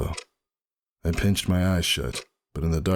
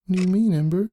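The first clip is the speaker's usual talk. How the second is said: higher, louder puzzled mare; confused Roseluck; 5 dB louder, faster than usual. A narrator's reading. Roseluck asks Ember what he means, confused. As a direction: ask him, baffled.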